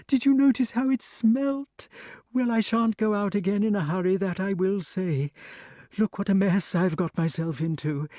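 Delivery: high, fast, breathless chatter; excited snake. A narrator's reading. Snake gushes to Wart about its scare, high and excited. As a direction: babble on, still in a flutter. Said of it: "went on excitedly".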